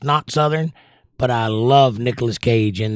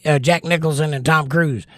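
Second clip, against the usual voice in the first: normal talking voice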